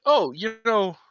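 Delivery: put-on sudden hesitation, voice jumping high; acted second thoughts. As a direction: pitch up a playful change of mind. Acting out sudden change of mind.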